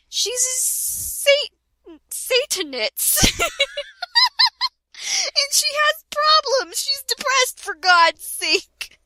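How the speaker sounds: mock-indignant, cracking up; laughing defence. Real, not acted. Reading the author's defence of her character, laughing.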